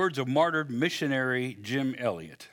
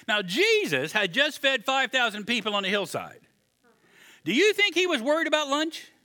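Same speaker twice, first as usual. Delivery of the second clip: normal talking voice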